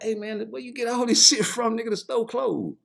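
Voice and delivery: high, loud, laughing disbelief at the haul; amazed. Retold or imitated. To his cellmate, amazed by the bag of goods, high and loud. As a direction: sound floored by what he's carrying. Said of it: "I like".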